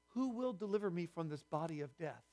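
soft, hushed quoted question; subdued plea. Retold or imitated. Preacher quoting what Paul says next, hushed plea. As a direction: ask it quietly, as a grave plea.

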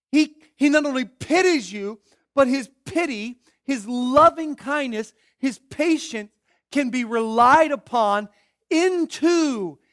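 loud, ringing pulpit climax; fiery preaching. Acted. Preacher urging his congregation to praise, loud fervent preaching. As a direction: preach it home at full voice.

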